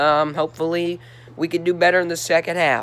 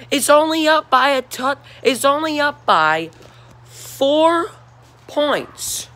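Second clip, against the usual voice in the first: incredulous; 10 semitones higher than usual. Real, not acted. Incredulous about Georgia's slim lead.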